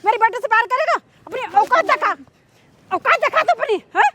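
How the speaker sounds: outraged mother's tirade; scornful, indignant scolding; angry scolding. Acted. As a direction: berate him with indignant questions. Acted mother challenges servant.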